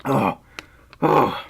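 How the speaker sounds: ordinary talk, nothing marked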